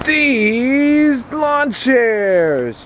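high wailing mock sob, very slow; fake crying. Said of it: "Just kidding".

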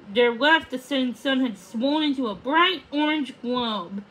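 usual speaking voice

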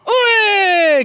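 startled shout. Real, not acted. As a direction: shout it out at the catch. Calling out at a catch.

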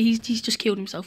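ordinary talk

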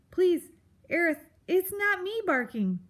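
high, loud mouse protest; anxious pleading. Acted. Mouse denies barking to the grump, anxious pleading. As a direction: plead innocence in a small creature's voice.

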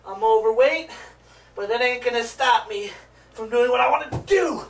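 ordinary talk, nothing marked

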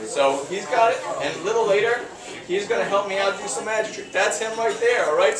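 normal talking voice

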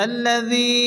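الذي with the ذي held one beat; lengthened dhi. Shown as a demonstration. Teacher showing students the one-alif stretch. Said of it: "এক আলিফ টেনে পড়তে হবে".

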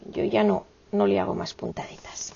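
whispered aside to herself. Real, not acted. Whispering to herself while finishing the stitches. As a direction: breathe it out under her breath.

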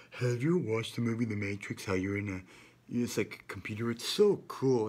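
mock-naive adult, voice lifted; gushing grown-up. Grown-up raving about The Matrix, mocking naive enthusiasm. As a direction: gush like a clueless movie fan. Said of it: "a fucking grown-up was like".

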